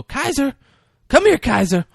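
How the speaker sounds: high, loud coaxing dog call; Mark Wahlberg voice. Retold or imitated. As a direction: sweet-talk the dog loudly and high. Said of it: "he hears Mark Wahlberg's voice go".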